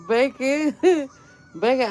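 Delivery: says the name through a high laugh; breaking into laughter mid-word. Real, not acted. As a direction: giggle it out.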